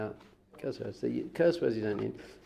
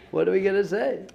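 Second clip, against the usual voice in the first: higher, louder incredulous question; mock bewildered protest; 7 semitones higher, 6 dB louder than usual. Students answering the rabbi's challenge, imitated baffled protest. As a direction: protest like a student left with nothing to say.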